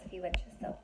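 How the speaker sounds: soft, fast, whispered aside. Real, not acted. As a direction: drop to a whisper for a quick aside. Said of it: "aside to listener".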